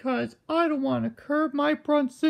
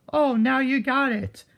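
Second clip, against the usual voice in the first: ordinary talk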